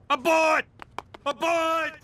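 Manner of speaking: panicked shout. Acted. Panics at man secretary.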